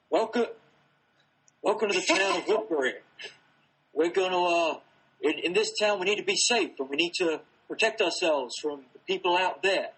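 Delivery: loud put-on character accent; the Governor. Amateur demonstrating an impression to the actor, loud put-on accent. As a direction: address the townsfolk like their leader. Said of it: "I can do a pretty good Governor accent".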